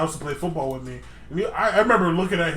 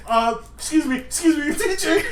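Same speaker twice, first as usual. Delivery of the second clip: higher, mock-frantic calling for the teacher; freaking out; 9 semitones higher than usual. Classmate calls the teacher for her friend, mock frantic. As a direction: imitate a panicked classmate, laughing through it. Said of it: "freaking out".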